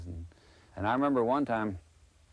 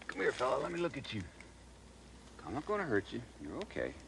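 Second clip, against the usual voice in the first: hushed and soothing, much softer; excited wildlife host whisper; 3 semitones higher, 9 dB quieter, faster than usual. Real, not acted. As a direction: gently coax a nervous snake. Soothing a snake in his hands.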